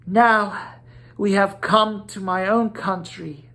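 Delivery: a hint of a pleading lady; Una's voice. A narrator's reading. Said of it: "said Una".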